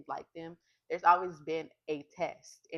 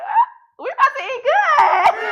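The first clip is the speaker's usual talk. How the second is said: much higher and louder, slow jubilant cry; shouting joy at finding back pay on the card; ecstatic shout; 20 dB louder, much slower than usual. Retold or imitated. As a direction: celebrate like you just won. Joy at the food stamps.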